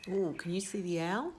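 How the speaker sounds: usual speaking voice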